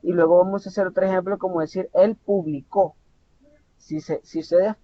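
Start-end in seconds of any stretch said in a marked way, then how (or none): 1.9-2.9 slow "él publicó", weight landing on CÓ; stress on last syllable. Shown as a demonstration. Teacher giving another example, last syllable of "publicó" stressed. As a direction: say publi-CÓ, punching the end. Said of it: "la forma de pronunciar".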